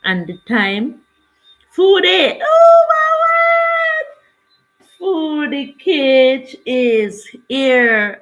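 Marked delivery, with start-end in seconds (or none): 1.7-4.1 high, loud delighted squeal at a viewer; excited shout. Real, not acted. Livestream host squeals with delight as a viewer joins. 5.0-8.2 loud, high, slow and delighted; excited announcement. Real, not acted. Host announces a viewer's arrival with loud joy.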